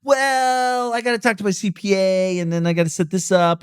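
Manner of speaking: high whiny put-on voice of a stalling client; mocking stalling excuse. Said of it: "If they say".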